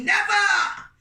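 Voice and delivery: high, loud outraged yell; defiant shout. Acted. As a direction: bellow the refusal. Vendors refusing name change.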